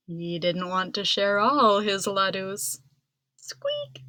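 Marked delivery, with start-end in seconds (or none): 3.4-4.0 tiny very high squeak; a soft mouse squeak. A narrator's reading. Mister Mouse squeaking beside Ganesha, very high squeak.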